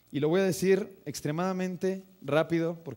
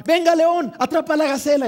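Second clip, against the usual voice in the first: much higher, loud excited yell; very excited cheering; 10 semitones higher, 10 dB louder than usual. Retold or imitated. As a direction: egg on the lion like an excited dad. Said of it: "y así muy emocionado".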